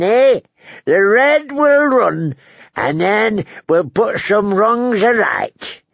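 put-on high, drawn-out old man voice; crotchety old lord. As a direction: play a querulous old host punctuating with "eh?".

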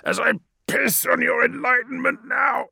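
high, loud shouted curse; dying captive shouting a curse; defiant dying curse. A narrator's reading. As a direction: curse him with your last breath.

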